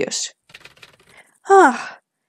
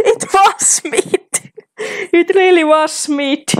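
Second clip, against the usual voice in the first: high, slow gleeful gloating; excited; 10 semitones higher, slower than usual. Real, not acted. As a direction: savour the win, laughing. Gloats at guessing right.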